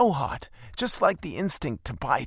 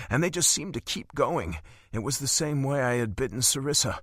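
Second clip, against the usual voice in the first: ordinary delivery, nothing marked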